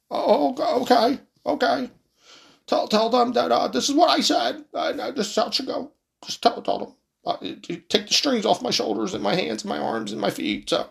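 high, thin put-on old man voice; hammed-up doddering old president; mock Biden asking for his nap. Comic old Biden impression.

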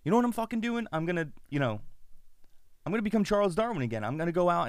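defiant rant. Retold or imitated. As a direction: declare the comeback in a fury. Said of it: "And he's just like".

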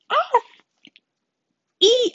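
very high, loud squeals; short high-pitched fangirl shrieks; excited squeal. Real, not acted. Excited about her Harry Potter gifts.

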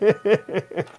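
real burst of laughter; laughing. Real, not acted. Cohost cracks up at the Nick Fury phone-call bit, laughing.

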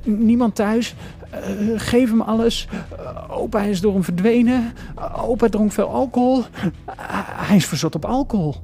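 high, frantic thinking aloud; panicked thinking. Acted. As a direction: grasp for each remembered rule, with hesitant stammers. Frantically recalling father's lessons. (paniekerig nadenkend)

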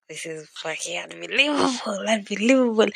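building to a loud second unbelievable; amazed exclamation. Retold or imitated. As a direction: let the disbelief swell into a shout. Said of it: "I was just like".

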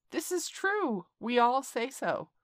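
high, loud shouted agreement; crowd shouting. A narrator's reading. Monkeys cheering their speaker, loud and high. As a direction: bellow it like a whole troop. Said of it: "they would all shout together".

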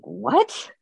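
retold high-pitched, disbelieving yelp; incredulous what. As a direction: act out being baffled by a threat, voice jumping up. Retelling startled reply to a threat.

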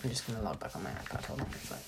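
quiet aside. Real, not acted. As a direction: drop out of the talk to mutter to yourself. Aside while reopening iPad.

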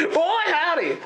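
high, hammed-up cowboy "Boy, howdy"; folksy drawl. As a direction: toss it off like a mock country yokel. Playful folksy exclamation about accents.

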